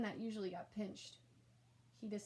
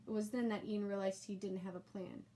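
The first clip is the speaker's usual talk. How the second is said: ordinary delivery, nothing marked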